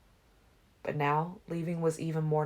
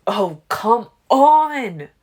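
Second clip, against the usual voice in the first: loud, slow exasperated bellow; 7 semitones higher, 14 dB louder, much slower than usual. A narrator's reading. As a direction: bellow it across the room. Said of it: "Emmett bellowed".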